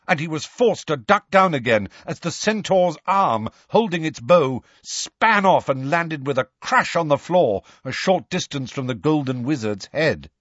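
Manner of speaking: plain reading voice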